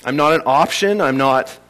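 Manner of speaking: ordinary talk, nothing marked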